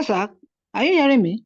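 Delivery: ordinary delivery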